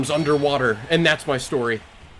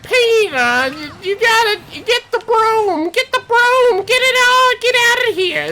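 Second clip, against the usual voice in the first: very high, loud cartoon wife voice; Lois; 5 dB louder than usual. Cartoon wife ordering husband to get the broom, high frantic falsetto. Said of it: "so Lois is like".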